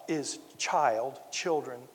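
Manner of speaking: put-on child and children slowed and stressed; emphasis on child. Shown as a demonstration. Preacher stressing "children" to the congregation, slow and deliberate. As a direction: lean on the word "child" to drive it home. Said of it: "I emphasize the word here".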